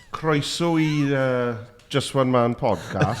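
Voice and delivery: slow, high, hammed-up Welsh accent; overly Welsh accent. Learner host welcoming listeners in Welsh, overdone Welsh accent. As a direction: lay the Welsh on thick. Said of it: "I put on an overly Welsh accent".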